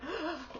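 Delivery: small emotional intake of breath; imitated little gasp; choked-up gasp. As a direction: catch your breath as if tearing up. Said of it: "I kinda got a little, like".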